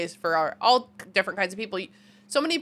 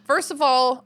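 normal talking voice

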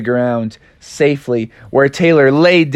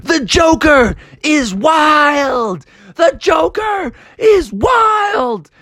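slow, high, ringing "The Joker is wild!"; victory chant called out slowly; triumphant shout; 13 semitones higher, much slower than usual. Acted. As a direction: crow it out, drawn out and high. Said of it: "he kept shouting".

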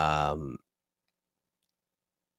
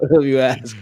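laughing; 8 semitones higher, 6 dB louder than usual. Real, not acted. Laughing at own joke.

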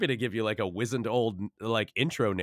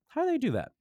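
much higher wondering question; childlike wonder; faster than usual. Retold or imitated. Guest recalling childhood awe at puppets, high-pitched. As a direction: ask it like an amazed kid. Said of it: "where I'm going".

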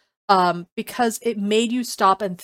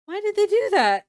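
high, baffled why; high incredulous question; puzzled question; 11 semitones higher, 4 dB louder than usual. Retold or imitated. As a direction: ask it like you can't believe it. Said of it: "I was like".

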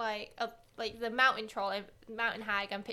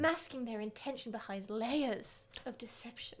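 dramatic reading; 6 dB quieter than usual. Acted. Reading monster card aloud.

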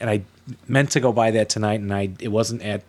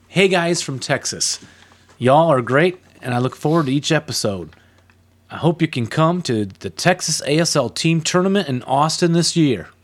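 hammed-up Southern accent, higher pitched; 4 semitones higher than usual. Host reading a Texas listener's letter, Southern accent. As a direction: read it as a friendly Southern caricature. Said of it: "Sorry if I'm butchering your accent".

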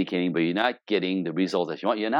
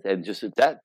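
ordinary talk

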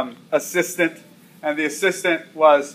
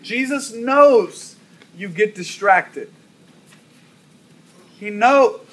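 higher, pleading preacher's build; rising sermon cadence, slower on the knows lines; preaching; 5 semitones higher, slower than usual. Acted. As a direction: hammer Jesus knows home to the congregation.

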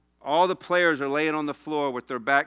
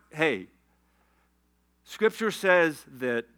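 ordinary talk

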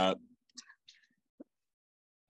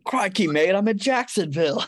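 higher, louder hammed-up Aussie voice; Aussie accent; 10 semitones higher, 5 dB louder than usual. Cohost topping the bad accent bit, louder hammed-up Aussie voice. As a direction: shout it like a cartoon Aussie. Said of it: "That's better, but still bad".

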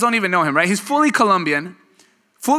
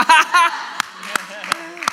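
big high laugh; 12 semitones higher than usual. Real, not acted.

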